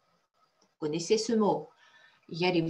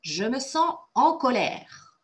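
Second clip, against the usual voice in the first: higher, emphatic mock anger; emotional anger demo; 4 semitones higher, 4 dB louder than usual. Shown as a demonstration. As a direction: model being angry with lots of emotion. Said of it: "répéter après moi avec beaucoup d'émotion".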